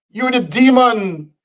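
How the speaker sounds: normal talking voice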